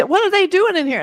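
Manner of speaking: jolted by a stranger sitting in the yard; alarmed. Retold or imitated. Imagining a stranger in the yard, startled alarm. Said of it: "it'd be".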